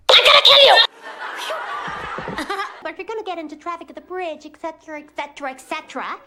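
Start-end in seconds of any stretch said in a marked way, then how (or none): 0.1-0.8 sudden furious yell, full force; threatening shout. Acted. Boy yelling a threat at the girl he loves. Threat ending love confession. 0.8-2.8 airy burst of laughter; breathy laugh. Real, not acted. Comic laughing at his own joke.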